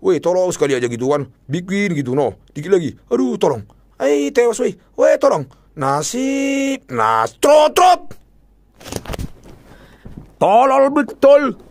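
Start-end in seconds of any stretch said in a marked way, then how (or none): 3.1-11.6 high, loud begging that turns to slow disgust; desperate pleading. Real, not acted. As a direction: beg the game then curse. Pleading as spin fails. (memohon panik)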